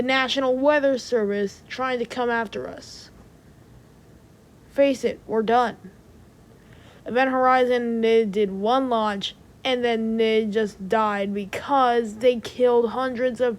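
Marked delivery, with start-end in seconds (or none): none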